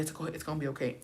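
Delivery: hushed, retold soothing; soft reassurance. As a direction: settle her down quietly. Soothing her angry mother-in-law.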